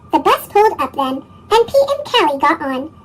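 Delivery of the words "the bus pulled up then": ordinary delivery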